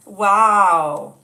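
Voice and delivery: big, loud amazed wow. Real, not acted. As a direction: let the wow burst out. Reacting to stepdad fact.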